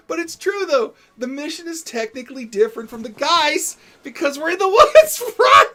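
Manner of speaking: laughing while being tickled. Real, not acted. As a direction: squeal through tickling.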